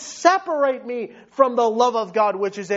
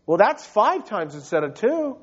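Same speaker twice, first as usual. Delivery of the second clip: ordinary delivery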